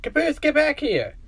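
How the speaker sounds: high-pitched call after a runaway; high acted holler. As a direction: call someone back urgently, voice high. Calling after runaway Caboose.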